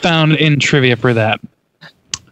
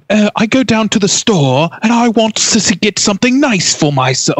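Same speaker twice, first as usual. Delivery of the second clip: higher, haughty attempt at a British accent; haughty mock British accent; 6 semitones higher than usual. Co-host mimicking the actor's failed British accent, haughty and exaggerated. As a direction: put on a bad, haughty mid-Atlantic accent. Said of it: "very haughty".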